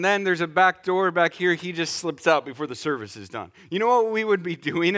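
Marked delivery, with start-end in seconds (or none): none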